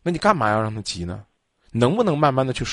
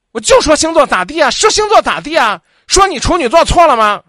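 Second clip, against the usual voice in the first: high, loud furious shouting back; mock angry retort; 13 semitones higher, 8 dB louder than usual. Host acts the caller yelling back at her boyfriend, high loud furious shout. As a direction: yell it right back like a girlfriend who won't back down. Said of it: "吓着我了都".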